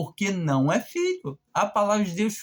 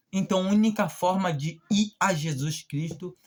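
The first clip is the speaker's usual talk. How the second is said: ordinary delivery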